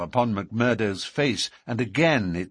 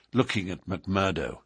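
ordinary delivery, nothing marked

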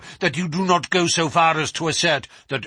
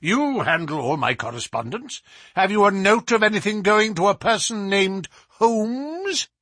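raised, commanding professor; higher, insistent, peremptory delivery; imperious questioning; 10 semitones higher, 3 dB louder than usual. A narrator's reading. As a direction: summon and grill an employee. Professor summons and questions secretary.

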